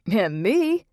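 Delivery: loud, high Jersey quip; cheeky Jersey interjection; Jersey accent. A narrator's reading. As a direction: pop it up, Jersey style. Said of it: "in his thick Jersey accent".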